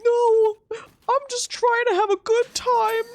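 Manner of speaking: high, slow, pleading whine; a mock innocent high whimper; whiny protest. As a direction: beg to be left alone, high and loud. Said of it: "I'm like".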